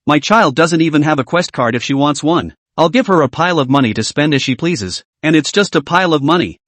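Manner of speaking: usual speaking voice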